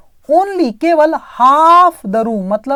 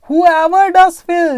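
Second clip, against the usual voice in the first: "does" in "Whoever does fill" hit louder and higher, very slow; stress on does; 6 semitones higher, 5 dB louder, much slower than usual. Shown as a demonstration. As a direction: punch "does" to mean really fills. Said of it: "थोड़ा सा stress डालने के लिए".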